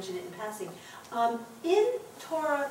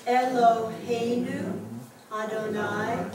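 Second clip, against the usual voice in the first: drawn-out words with pauses between; slow group recitation; much slower than usual. Shown as a demonstration. Teacher leading class through the Shema, slowly word by word. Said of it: "as we go from word to word".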